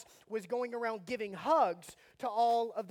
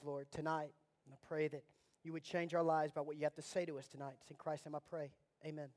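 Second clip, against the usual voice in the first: soft, low prayer voice; 6 semitones lower, 6 dB quieter than usual. Acted. Pastor praying over the congregation, soft and low. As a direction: pray quietly and earnestly. Closing prayer before sermon.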